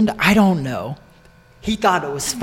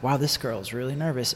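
inner thought; 4 semitones lower, 10 dB quieter than usual. Retold or imitated. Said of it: "I was like".

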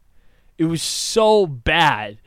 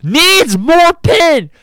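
slow, loud, high mocking wail of the catchphrase; drawn-out yelled taunt at a teammate; mock shout; 9 semitones higher, 8 dB louder than usual. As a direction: drag it out loud to mock him.

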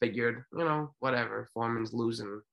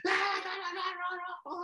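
crowd hollering; 4 dB quieter than usual. Retold or imitated. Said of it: "Don King's boys in the background yelling".

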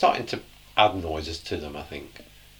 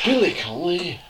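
put-on Scottish accent; 10 semitones higher than usual. Naming comedian in his accent.

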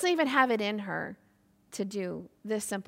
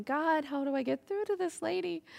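lightly voiced, higher-pitched exasperated plea; Jesus; 5 semitones higher than usual. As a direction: appeal upward, a touch at a loss. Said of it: "you probably have Jesus going".

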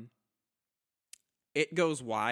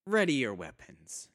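commanding drow leader; 5 dB louder than usual. Acted. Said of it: "turns to the rest and goes".

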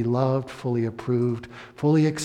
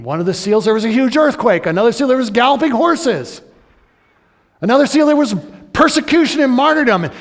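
much louder and higher, racing through the seals; preaching climax; 11 semitones higher, 12 dB louder, faster than usual. Acted. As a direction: build the list up to a shout.

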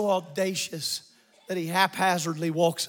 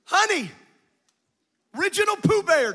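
high, ringing preacher's delivery; full-force preaching; 12 semitones higher, 6 dB louder than usual. Acted. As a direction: call it out to the congregation.

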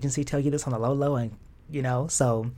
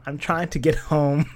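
plain reading voice

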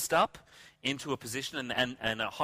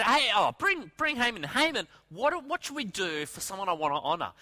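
higher, louder summons at first; king calling out; 6 semitones higher, 3 dB louder than usual. Acted. King summons Haman to ask a question, calling out. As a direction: call him in eagerly, as an acted king.

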